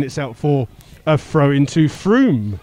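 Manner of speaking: ordinary talk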